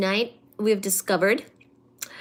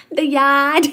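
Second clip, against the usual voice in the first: louder Boston accent try; 4 semitones higher, 8 dB louder than usual. Shown as a demonstration. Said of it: "Can you park the car in the Harvard yard".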